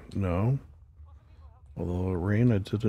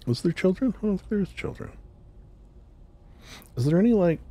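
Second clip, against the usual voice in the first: alarmed; 11 semitones higher, faster than usual. Real, not acted. Worried about killing children.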